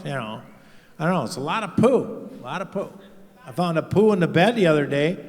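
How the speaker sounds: normal talking voice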